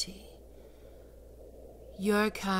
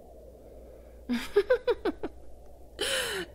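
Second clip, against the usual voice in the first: high, rising delighted cackle; high delighted laugh. Acted. Succubus laughing at her clever pet, high delighted laugh.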